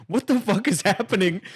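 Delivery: high-pitched baffled outcry, imitated; mock bewilderment. Couple watching the burglar's antics, mock bewilderment. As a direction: play an appalled onlooker.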